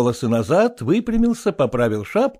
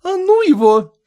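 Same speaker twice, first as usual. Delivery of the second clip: annoyed brush-off, higher and louder; displeased; 7 dB louder than usual. A narrator's reading. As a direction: wave it off with displeasure. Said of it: "с неудовольствием произнесла".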